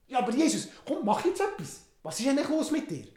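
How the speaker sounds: high, loud, pushy nagging; impatient Herod. Acted. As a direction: prod him to finally do something. Herod impatient at silent Jesus.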